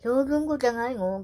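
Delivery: 驚いたふりで「えー？」; own surprise. Retold or imitated. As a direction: retell your own startled reaction. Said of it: "って".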